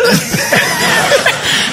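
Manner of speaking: very high squealing laugh; high burst of laughter. Real, not acted. Teller laughing at own joke, high squeal. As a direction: break up laughing.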